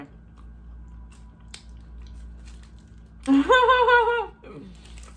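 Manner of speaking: loud high burst of laughter; high laughing fit. Real, not acted. Laughing fit while eating.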